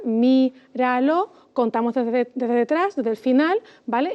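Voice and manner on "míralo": "míralo" as mí, ra, lo, slowly; slow "míralo", each syllable apart; split into syllables. Shown as a demonstration. Teacher separating the word to count, slow syllables. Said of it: "empezamos otra vez a separar".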